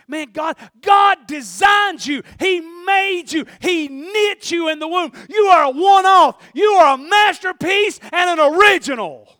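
high, drawn-out preacher shout; impassioned pulpit holler; full-force preaching. Real, not acted. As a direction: belt out each phrase to lift the congregation.

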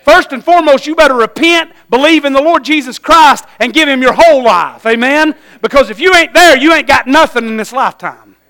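loud, high preacher's call to repent; full-force preaching. Acted. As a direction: preach it hard to the congregation.

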